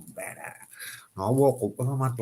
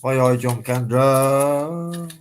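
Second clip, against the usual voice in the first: calling out; 3 semitones higher, 9 dB louder, much slower than usual. Real, not acted. Calling out greeting to passersby.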